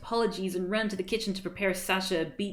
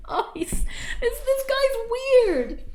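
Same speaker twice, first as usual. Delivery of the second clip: laughing disbelief; 6 dB louder, slower than usual. Real, not acted.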